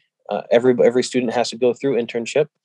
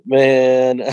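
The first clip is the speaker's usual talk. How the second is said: a rueful laugh breaking into the words; 2 semitones higher than usual. Retold or imitated. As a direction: laugh helplessly at the situation. Retelling his struggle with God.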